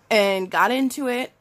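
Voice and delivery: usual speaking voice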